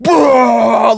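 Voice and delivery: loud, high imitated retch; projectile vomiting noise. Man vomiting into the trash can, loud imitated heave. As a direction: heave out a long mock vomit roar. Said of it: "the dude just projectile vomits".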